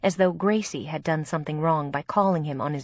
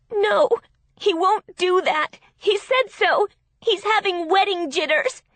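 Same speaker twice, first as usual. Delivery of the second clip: high, loud distraught protest; upset, wailing excuse; desperate denial; 13 semitones higher, 6 dB louder than usual. A narrator's reading. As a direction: insist on it frantically, pitched way up. Bride desperately defends her fiancé.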